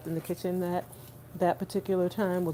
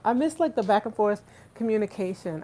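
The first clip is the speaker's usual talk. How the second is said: usual speaking voice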